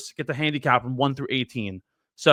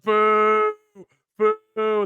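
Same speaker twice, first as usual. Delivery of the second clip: higher, drawn-out mock boo; imitated booing; 5 semitones higher, 3 dB louder than usual. Crowd hearing his team's score, mock booing. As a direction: imitate a crowd's long boos. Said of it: "They're all, they're all booing".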